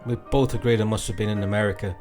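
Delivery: ordinary delivery, nothing marked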